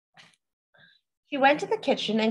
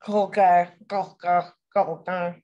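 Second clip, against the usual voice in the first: backwards frog croak; 5 semitones lower, 3 dB louder than usual. Acted. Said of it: "said it backwards".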